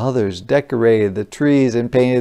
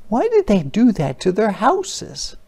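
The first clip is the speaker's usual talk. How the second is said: higher-pitched puzzled wondering; puzzled question; 10 semitones higher than usual. Acted. Onlooker wondering about the houses, puzzled.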